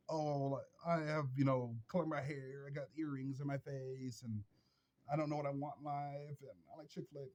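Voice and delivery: soft, limp mocking voice; mock liberal guy. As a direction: make him sound aimless and meek.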